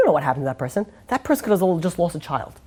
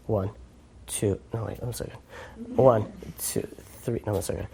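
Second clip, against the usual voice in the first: much softer, low, fussy counting mumble; man counting hundred-dollar bills, mumbling low; obsessive money counter; 12 semitones lower, 11 dB quieter than usual. Retold or imitated. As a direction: lose count and start over, under your breath. Guy counting his cash.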